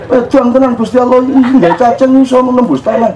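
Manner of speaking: lightly voiced child, amazed; child's wonder. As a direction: marvel at the worm like a small boy.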